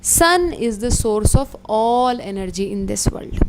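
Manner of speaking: very slow, every word weighted; teacher stating the key fact, very slowly; slow dictation. Shown as a demonstration. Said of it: "मैं बता देती हूँ".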